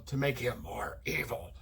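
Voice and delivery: low, soft mock villain voice; mockingly dark and menacing; over-dramatized evil. Shown as a demonstration. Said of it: "over dramatize his voice".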